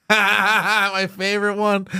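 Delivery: stretched out slowly through real laughter; a slow, giggling "my favorite one"; laughing. Real, not acted. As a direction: laugh while you get the words out. Laughing at the memory.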